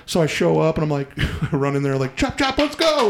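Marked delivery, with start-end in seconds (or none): 2.2-3.1 high-pitched, eager rallying call; enthusiastic hustle. Retold or imitated. Driver hurrying into the store to collect orders, enthusiastic. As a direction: rush them along with gung-ho energy. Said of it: "I'm all enthusiastic".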